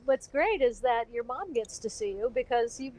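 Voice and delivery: normal talking voice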